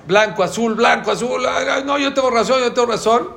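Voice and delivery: high, loud insistent arguing; hammed-up insisting you're right; mock stubborn arguing. Imitating stubborn study argument. (discusión terca exagerada, aguda y fuerte)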